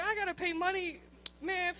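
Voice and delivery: ordinary talk, nothing marked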